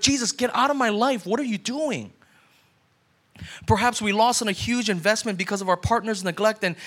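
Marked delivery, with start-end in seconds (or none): 0.0-2.1 mock angry. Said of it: "you're like".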